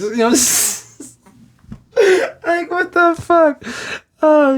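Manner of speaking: high, slow, laughing it out; breaking up with laughter. Real, not acted. Cracking up at his own pizza story, laughing.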